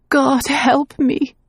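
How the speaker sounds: high, slow plea; desperate prayer. Acted. Remembered voice praying, desperate. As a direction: beg heaven for help. Pleading voice.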